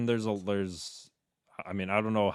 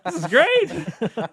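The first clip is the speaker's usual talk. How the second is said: gullible fan gushing; 10 semitones higher, 9 dB louder than usual. Pretend. Fan fooled by press conferences, rising gushing amazement. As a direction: gush like a fan who believes every word. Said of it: "then you're just like".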